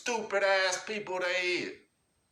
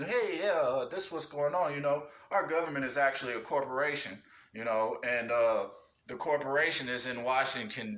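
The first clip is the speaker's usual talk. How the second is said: being nice; 7 semitones lower than usual. Pretend. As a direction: play it gentle and patient, easing someone in. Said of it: "be nice and".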